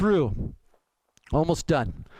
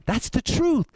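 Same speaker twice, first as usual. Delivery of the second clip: ordinary delivery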